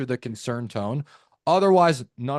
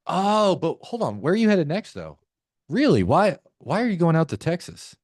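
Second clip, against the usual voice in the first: curious tone; 6 semitones higher, 4 dB louder than usual. Shown as a demonstration. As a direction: sound genuinely puzzled and interested, pitch up. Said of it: "One is curious".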